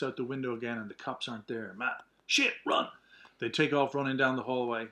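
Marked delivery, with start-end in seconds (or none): 2.3-2.9 urgent whispered shout. Acted. Fleeing the cops.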